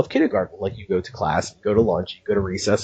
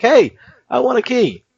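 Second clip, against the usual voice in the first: mock eager fan; 10 semitones higher, 3 dB louder than usual. Imitating a listener asking.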